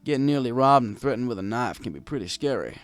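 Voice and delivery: plain reading voice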